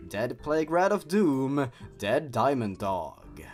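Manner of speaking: normal talking voice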